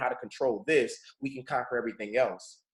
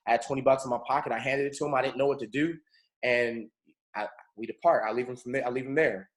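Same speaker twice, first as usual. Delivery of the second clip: ordinary delivery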